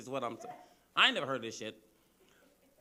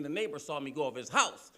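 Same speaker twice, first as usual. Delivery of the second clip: usual speaking voice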